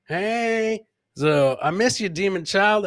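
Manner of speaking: usual speaking voice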